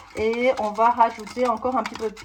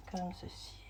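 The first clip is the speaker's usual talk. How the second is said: whispering; 6 semitones lower, 21 dB quieter than usual. Real, not acted. Quiet aside while cutting lemon.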